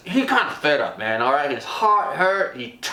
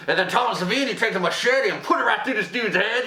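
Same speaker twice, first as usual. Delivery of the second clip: usual speaking voice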